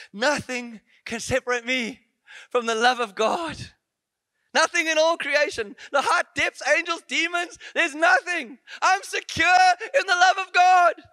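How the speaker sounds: rising from steady to a high, loud cry; building preached scripture. Acted. Preacher proclaiming the verse to the congregation, building to a shout.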